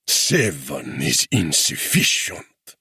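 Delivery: slow growl from a ruffian. A narrator's reading. Ruffian refuses the seven cannon, slow growl. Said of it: "growled the ruffian".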